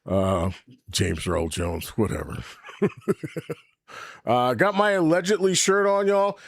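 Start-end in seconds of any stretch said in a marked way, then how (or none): 2.7-3.5 laughing. Real, not acted. Laugh alone.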